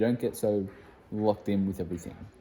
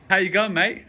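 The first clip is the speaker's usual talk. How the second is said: hammed-up Australian accent, high and loud; a playful Aussie greeting in a broad Australian accent; 10 semitones higher, 9 dB louder than usual. Vlogger greets friends off to America in a mock Australian accent. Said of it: "put on the Australian accent".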